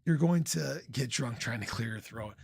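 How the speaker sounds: ordinary delivery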